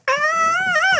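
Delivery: very high-pitched excited squeal; mock high fanboy scream. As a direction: let out a starstruck fanboy shriek. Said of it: "It's a little fanboy in mine".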